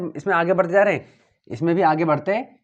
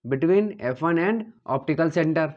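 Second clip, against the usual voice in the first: slow dictation; much slower than usual. Shown as a demonstration. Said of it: "ये क्या है?".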